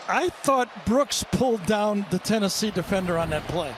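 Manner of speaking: normal talking voice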